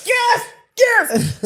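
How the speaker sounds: high-pitched gleeful yes; acted-out inner victory cry; triumphant cheer. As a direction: celebrate a secret win. Acting out inner triumph at entry.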